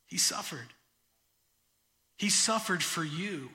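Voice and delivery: usual speaking voice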